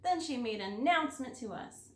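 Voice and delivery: ordinary delivery, nothing marked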